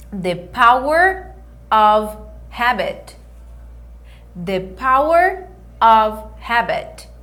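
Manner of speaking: The Power of Habit, slow, stress on Power; slow English model. Shown as a demonstration. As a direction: say Power of Habit slowly, habit with an open h. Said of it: "Hábito em inglês a gente fala habit". (pronúncia lenta em inglês)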